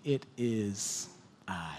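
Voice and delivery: slow whispered "it is I". Acted. Preacher savouring Jesus' words to disciples, whispered. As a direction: hush it, slow and intimate.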